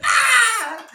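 shrill mock baby scream; the baby's cry when the rock hits; high mock scream. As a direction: yelp like the hit baby. Rock hitting baby sound.

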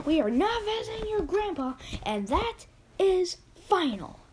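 high-pitched cranky cat voice. Acted.

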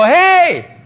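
high, loud comic yell as a rebelling stomach; hammed-up shout of protest; screaming stomach. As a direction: holler up indignantly like a neglected belly. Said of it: "stomach is gonna start screaming".

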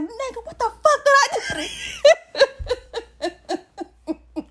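high, loud, laughing mock outburst; hammed-up high yell at the boyfriend; retold confrontation. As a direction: come in hot while laughing through it. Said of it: "Full steam with my hand all up in his face".

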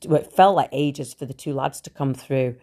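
ordinary delivery

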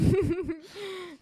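laughs up high in a sudden burst; a sharp high laugh; high burst of laughter. Real, not acted. Laughing hard at her friend's teasing, high burst of laughter.

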